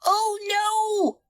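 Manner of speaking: very high, squeaky cry; high-pitched alarm; dismayed monkey. A narrator's reading. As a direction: realise your mistake in a high voice. Monkey realises spanner is missing.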